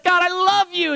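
high, loud cry of love; exuberant devotion. Acted. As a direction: shout your love up to God. Acts a high moment of worship.

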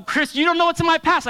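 loud, high protesting voice; objecting listener. Retold or imitated. Imagined listener protesting to pastor Chris, loud and high. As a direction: push back hard like a hurt listener. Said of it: "So you can go".

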